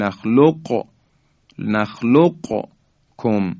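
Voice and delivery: slow نخلقکم with q released, then kum; qaf without merging. Shown as a demonstration. Teacher shows the permitted second way, qaf pronounced unmerged. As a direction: sound the q of نخلقکم separately from the k. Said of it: "قاف رو تلفظ کنیم و در کاف هم ادغام نکنیم".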